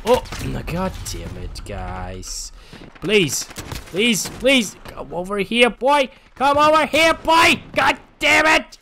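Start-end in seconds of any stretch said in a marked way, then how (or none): 3.0-8.8 higher and louder, building to a shout; frustrated yelling. Real, not acted. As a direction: plead then yell at the enemy. Begging enemy to approach, then fails.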